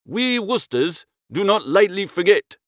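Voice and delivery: usual speaking voice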